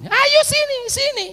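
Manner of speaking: put-on high-pitched beckoning call to shy kids; calling over. Memanggil anak-anak yang malu. (memanggil dengan nada tinggi)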